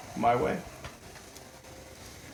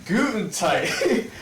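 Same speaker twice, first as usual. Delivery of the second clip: much higher, drawn-out greeting; goofy character voice; 6 semitones higher, slower than usual. Acted. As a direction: call out cheerfully in a silly high voice. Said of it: "I'm not even gonna do a Scottish accent".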